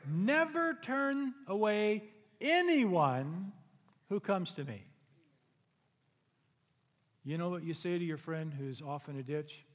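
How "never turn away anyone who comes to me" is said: loud, higher, slowing on never turn away; loud Scripture climax; loud proclaimed verse. Acted. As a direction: proclaim it to the back row. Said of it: "So Jesus says".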